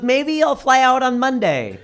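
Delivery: mother-in-law. Acted. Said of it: "And she goes".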